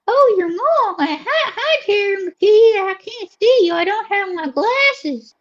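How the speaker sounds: high, swooping Southern lady; Ruby Chapeau. Acted. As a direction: play a Southern belle with a high drawl. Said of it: "This is, uh, Ruby Chapeau".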